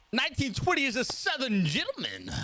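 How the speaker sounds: a drifting Southern gentleman voice; mock Southern gentleman; Southern gentleman accent. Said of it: "I don't even know what accent that was becoming".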